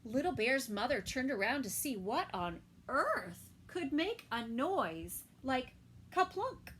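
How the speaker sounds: usual speaking voice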